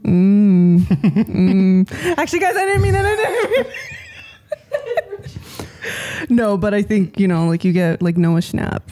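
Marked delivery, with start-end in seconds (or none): 2.2-3.7 high, laughing fake apology; mock retraction. 4.5-6.2 breathy high-pitched giggle; high airy laugh. Real, not acted. Laugh after retraction.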